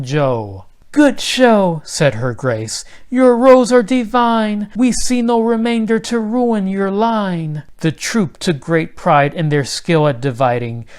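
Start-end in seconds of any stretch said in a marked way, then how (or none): 0.9-1.8 high, loud regal praise; a queen beaming over her troops; delighted queen. Acted. 3.1-7.6 a queen beaming over her troops; delighted queen. Acted. Pleased queen praises the troop.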